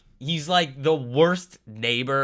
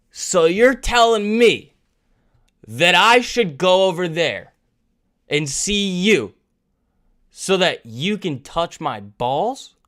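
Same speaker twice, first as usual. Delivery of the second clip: slow, deliberate disbelief, starting high and loud; mock incredulous; 3 semitones higher, 4 dB louder than usual. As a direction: spell it out as an absurd question. Retells his mock-incredulous comeback.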